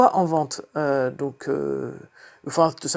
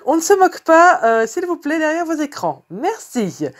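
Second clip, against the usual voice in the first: mock plea; 11 semitones higher, 6 dB louder than usual. Asks viewers not to mock her.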